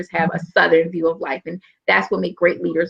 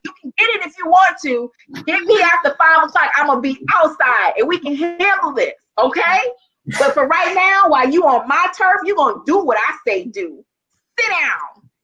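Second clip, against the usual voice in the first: tough challenge; 8 semitones higher than usual. Retold or imitated. Squaring up to defiant students.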